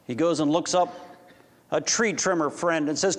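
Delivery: ordinary delivery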